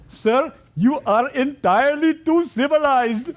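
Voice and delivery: slow, laughing imitation of an Indian accent. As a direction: play the local man in an Indian accent, slowly, laughing. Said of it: "I'll apologize in advance for the accent".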